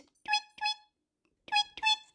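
very high, soft chirping tweets; bird tweets. Pretend. Imitating the parakeet for babies, high tweets. Bird sound in baby rhyme.

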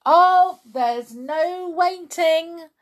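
high, loud, drawn-out protest; overexcited outburst; worked-up cry. A narrator's reading. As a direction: cry out, brushing the objection aside. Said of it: "cried Mister Cranky, working himself up".

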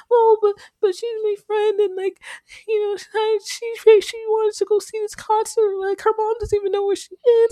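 high, loud tearful imitation; crying friend. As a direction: sob it out high and distressed. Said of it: "She was, like, crying".

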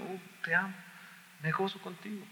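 very soft, loving murmur; soft intimate voice of God; tender hushed voice of God. Acted. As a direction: breathe the words like a loving father.

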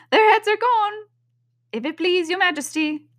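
very high put-on shout, easing off; shouted reply. A narrator's reading. Soldiers reporting to the queen, high shouted reply. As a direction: call it up to the queen. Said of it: "the soldiers shouted in reply".

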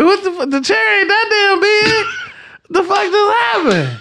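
high mock-shocked outburst. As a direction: play up the shock. Retelling shock at the fall.

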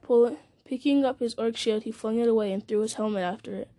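ordinary delivery, nothing marked

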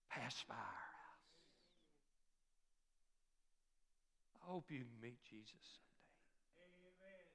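soft, breathy secret of wonder; hushed whisper. Acted. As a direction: whisper it with awe. Hushed awe after testimony.

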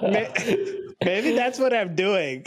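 breathless high laugh, then words; choking laugh. Real, not acted. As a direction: laugh till you choke. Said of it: "You sound like you're choking".

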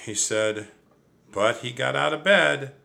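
plain reading voice

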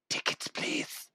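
very soft, slow whisper; comic whisper. Acted. Ticket inspector addressing passengers, absurdly whispered. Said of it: "It wasn't whispered".

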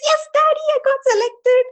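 delighted daughter sharing big news; thrilled announcement. Retold or imitated. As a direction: tell dad the news, beaming. Telling dad the good news.

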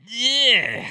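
loud, high groan of revulsion; exaggerated cringing groan; disgusted ugh. Real, not acted. Disgust at the candidate's smile.

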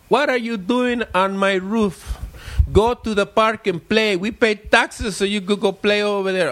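put-on high, slow, grumpy old-man scolding; scolding neighbor. Neighbor scolds kids on his roof, slow high grumbling. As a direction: tell the kids off from the porch.

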